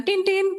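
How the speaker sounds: high beeping horn sound, two quick toots; imitated car horn. Telling how her cousin's car honked outside, mock horn beeps. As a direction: mimic a car honking twice.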